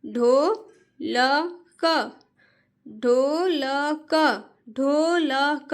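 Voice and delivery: "ढोलक" drawn out very slowly each time; very slow reading. Shown as a demonstration. Teacher reads "ढोलक" slowly for children to copy. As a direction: stretch "ढोलक" syllable by syllable. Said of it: "जिस तरीके से मैं पढ़ रही हूँ".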